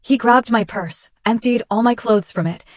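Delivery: plain reading voice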